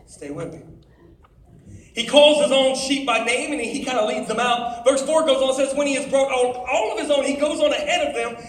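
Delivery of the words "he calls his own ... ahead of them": higher, louder preaching read; preaching scripture read. Acted. Preacher reading John ten to teens, higher and louder. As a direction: proclaim the passage to the crowd.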